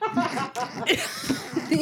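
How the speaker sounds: high, softened burst of laughter; re-enacted laughing fit. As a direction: act out cracking up at the worst moment. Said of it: "I start laughing".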